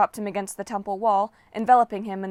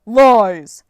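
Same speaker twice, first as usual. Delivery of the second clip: loud, furious denial; a giant raging at the demigod; angry roar; 15 dB louder than usual. Acted. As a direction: spit the word out in rage. Giant denies Jason's warning.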